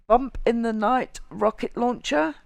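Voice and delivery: ordinary talk, nothing marked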